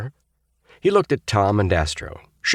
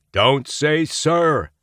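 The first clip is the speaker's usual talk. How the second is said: stern; 9 semitones higher, 3 dB louder, much slower than usual. A narrator's reading. Astro scolding the cadet.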